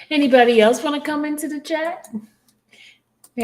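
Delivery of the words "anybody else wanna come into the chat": higher, louder open invitation to the audience; calling out. Real, not acted. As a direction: call out to everyone listening, inviting them in. Host inviting listeners on air.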